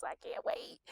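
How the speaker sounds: excited whisper. Real, not acted. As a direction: hush it out like you can barely contain it. Whispered repeat of can't wait.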